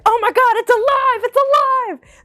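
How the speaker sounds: high, loud panicked cry; high frightened shout. Retold or imitated. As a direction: shriek it in fright. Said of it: "I was like".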